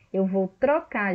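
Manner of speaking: ordinary talk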